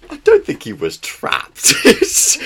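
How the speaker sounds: loud, high-pitched amused objection; laughing protest. Real, not acted. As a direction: protest through real laughter.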